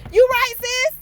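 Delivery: high, loud, fired-up agreement; yelling yes to the YouTuber who called her out; excited shout. Real, not acted.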